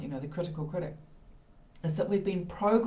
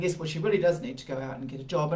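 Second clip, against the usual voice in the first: ordinary talk, nothing marked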